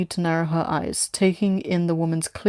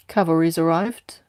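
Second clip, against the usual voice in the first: ordinary delivery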